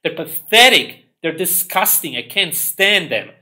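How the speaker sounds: loud, high, spat-out disgust; loud sneering disgust; disgusted outburst. Real, not acted. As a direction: spit the word with contempt.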